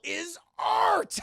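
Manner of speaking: high, loud shouted declaration; booming giant. Retold or imitated. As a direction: proclaim it grandly.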